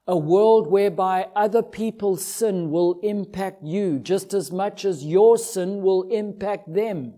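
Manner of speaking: ordinary talk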